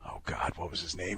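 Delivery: whispered to himself, searching his memory; breathy half-whisper while trying to remember. Real, not acted. Host straining to recall a forgotten draft pick, whispering.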